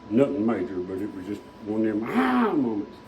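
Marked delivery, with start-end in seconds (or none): 2.1-2.5 a quick, much higher "ah" of alarm; mock dismayed "ah". Retelling the car's vibration scare, mock dismayed "ah". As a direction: toss off a jumpy little "ah".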